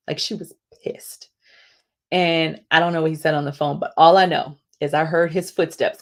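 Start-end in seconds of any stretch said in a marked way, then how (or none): 0.1-1.3 hushed, breathy confiding; whispered aside. Real, not acted. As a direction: lean in and whisper it. Confiding aside about boss.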